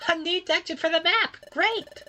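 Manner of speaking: bright, rising delight at a discovery; excited. Acted. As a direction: burst out with joy. Exciting discovery in game dialogue.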